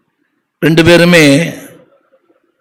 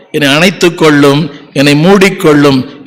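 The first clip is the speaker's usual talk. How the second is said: usual speaking voice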